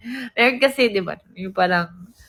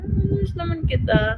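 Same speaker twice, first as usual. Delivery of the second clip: imitated friend, high-pitched; high cooing reply; 7 semitones higher than usual. Friend replying to the speaker, high cooing.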